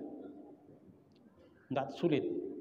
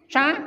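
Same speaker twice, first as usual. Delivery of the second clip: "Cha" high, loud and rising, meaning tea; 9 semitones higher, 8 dB louder than usual. Shown as a demonstration. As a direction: lift "Cha" sharply into the tone for tea. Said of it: "Intonasinya pas". ("Cha" dengan nada tinggi naik yang berarti teh)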